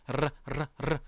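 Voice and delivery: "르" as short tongue trills; rolled R. Shown as a demonstration. Teacher demonstrating the hardest Spanish sound, rolled R. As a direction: trill "르" three times, separately. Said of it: "R 발음".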